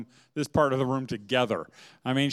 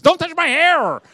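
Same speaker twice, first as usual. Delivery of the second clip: high, loud mock shriek; wife's outraged yell; 14 semitones higher, 11 dB louder than usual. Wife to husband touching her hair in church, outraged yell. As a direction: yell it as a comic outburst.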